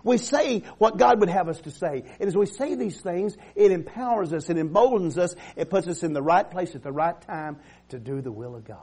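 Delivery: rising, declaiming preacher cadence; fervent preaching. Acted. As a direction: preach it to the congregation with conviction. Preaching a decree to congregation.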